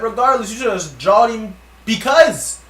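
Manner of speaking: loud, high, insistent comeback; exasperated retort. Retold or imitated. Telling Leaf what he shoulda done, exasperated retort. Retold heated argument with Leaf.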